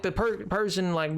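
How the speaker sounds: ordinary talk, nothing marked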